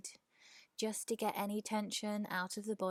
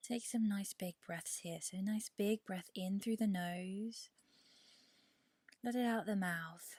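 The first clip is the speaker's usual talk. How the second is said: guided meditation. Real, not acted. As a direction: ease them into rest, unhurried and measured. Leads a yoga nidra meditation.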